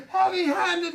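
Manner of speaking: high laughing mimicry; mock his words with a laugh. Boss laughing while mocking the new manager's words, high pitched. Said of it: "he said".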